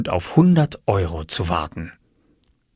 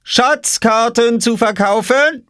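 very high, drawn-out hawker's cry; calling out; 14 semitones higher, 4 dB louder, slower than usual. A narrator's reading. Oskar baiting his sister from behind his crate, calling out. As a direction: sing it out slowly as a child vendor. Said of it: "rief Oskar hinter seiner Obstkiste".

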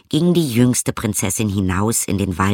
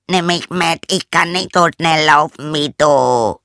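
loud, high-pitched frog plea; frog calling out; 7 semitones higher, 5 dB louder than usual. Acted. Frog begs the fleeing princess to wait, shouting. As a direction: shout it after someone as a frog left behind. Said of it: "rief der Frosch".

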